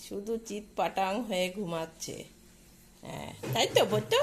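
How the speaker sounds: normal talking voice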